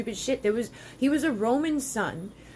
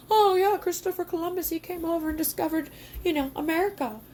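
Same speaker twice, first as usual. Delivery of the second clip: mocking quote; 8 semitones higher than usual. Mocking the official story.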